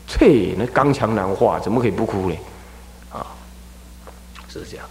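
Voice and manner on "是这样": ordinary delivery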